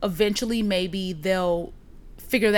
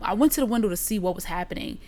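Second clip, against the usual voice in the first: normal talking voice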